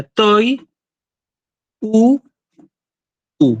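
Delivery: "toi, u, u" in isolated syllables with long pauses. Shown as a demonstration. Teacher dividing a Greek word for students, syllable by syllable. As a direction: split "toi, u, u" into three separate beats. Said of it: "separar esta palabra en sílabas".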